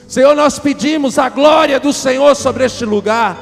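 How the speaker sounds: loud, high-pitched prophetic prayer; impassioned shouted declaration, building then easing at the end; full-force preaching. Real, not acted. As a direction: cry out to God and congregation at full voice.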